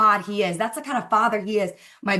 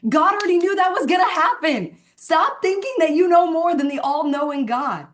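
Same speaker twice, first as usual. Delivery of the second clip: higher and louder, full preacher force; preaching; 7 semitones higher, 4 dB louder than usual. Real, not acted. Telling the team to stop worrying, preached higher and louder. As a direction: press it home like a sermon.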